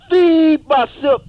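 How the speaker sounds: loud, stretched slow shout. Acted. He shouts at the man that he's feeding himself. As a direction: bellow it out slowly. Choking bit, shouted answer.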